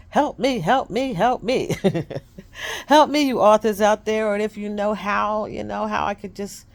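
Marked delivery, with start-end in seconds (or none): 0.1-4.3 higher, louder repeated cries of help me; hammed-up call for rescue, half laughing; mock plea for help. Author jokingly begging her viewers for book signings, loud mock plea.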